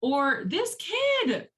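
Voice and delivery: high excited ranting; worked-up teacher venting to husband; retold venting. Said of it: "I'm like".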